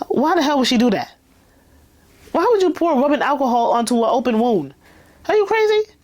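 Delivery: high, fast incredulous questioning; appalled, rapid-fire objection with pitch jumping up; indignant disbelief. Real, not acted. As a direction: scold the character in outraged amazement. Outraged aside at the character.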